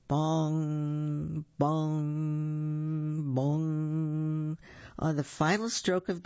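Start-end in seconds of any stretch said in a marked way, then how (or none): 0.1-4.6 slow, even, ringing bongs; clock chime. Acted. Clock strikes midnight in story.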